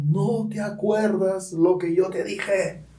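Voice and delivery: slow, lifted, booming divine voice; a hint of a thundering heavenly voice; thundering voice of God. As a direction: let it roll slowly like thunder from above. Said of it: "una voz que tronó en mis oídos".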